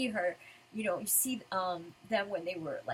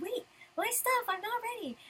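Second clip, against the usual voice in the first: screaming Lucy; 13 semitones higher than usual. Acted. Lucy not ready to leave, mildly acted scream. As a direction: protest in a flustered squeal. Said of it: "then Lucy's screaming".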